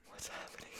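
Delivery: whispered, awed question; stunned whisper. Retold or imitated. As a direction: breathe it out in shock. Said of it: "we were like".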